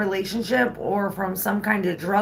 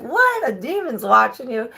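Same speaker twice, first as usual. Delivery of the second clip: shocked; 6 semitones higher than usual. Retold or imitated. Mom shocked by the news.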